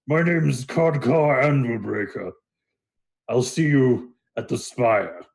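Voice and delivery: drawn-out Minotaur greeting; Minotaur introducing himself. Acted. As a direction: introduce yourself ponderously, fumbling the name. Minotaur introduces himself, fumbling the name.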